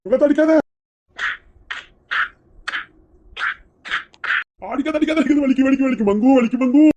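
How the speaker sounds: high, pained begging; pleading yelps. Acted. Husband begs his wife to stop beating him, high and pained. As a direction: beg her to stop hitting, voice up high.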